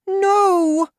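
shrill high loud shout of no; Sophie crying out. A narrator's reading. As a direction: cry out in despair. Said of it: "cried Sophie".

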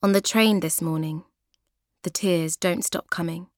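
ordinary delivery, nothing marked

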